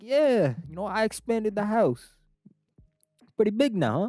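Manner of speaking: high, loud mock-Italian boast; big Italian caricature, pitched high; Italian accent. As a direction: brag in a comic Italian accent. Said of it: "us Italians".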